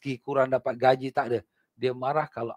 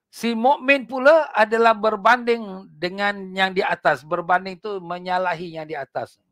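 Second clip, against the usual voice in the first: fiery preaching; 7 semitones higher, 6 dB louder than usual. Acted. Preacher warning the congregation about hypocrites, fiery. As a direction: drive the warning home from the pulpit.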